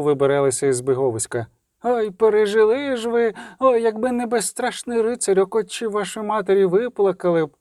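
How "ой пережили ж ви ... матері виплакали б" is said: high-pitched fussing lament; lamenting townswoman. Acted. Stout townswoman to the children leaving, lamenting. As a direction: wail sympathy in a high voice.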